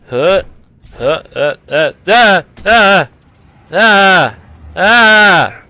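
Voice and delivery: startled "ah" cries, building in volume; mock panicked cries. Startled by his foot being moved.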